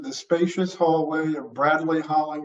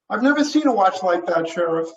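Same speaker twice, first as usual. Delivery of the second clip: higher, eager and fast; young deputy voice; 4 semitones higher, 5 dB louder, faster than usual. Acted. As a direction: play the keen rookie deputy.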